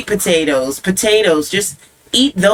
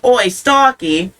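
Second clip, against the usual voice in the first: higher, drawn-out mock British call; British accent; 4 semitones higher, slower than usual. Shown as a demonstration. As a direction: call out like a Cockney. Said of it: "can you do it in a British accent?".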